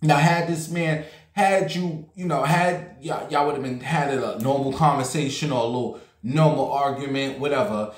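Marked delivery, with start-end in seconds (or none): none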